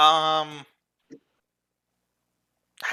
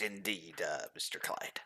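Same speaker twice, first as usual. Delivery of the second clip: whispered aside; 4 semitones lower, 11 dB quieter, slower than usual. Acted. [name] drops to a whisper.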